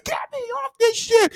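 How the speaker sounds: high panicked scream from the back of the bike; shrieking, slowed terror scream. Retold or imitated. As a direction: scream it like you want off right now. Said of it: "screaming at the top of my lungs".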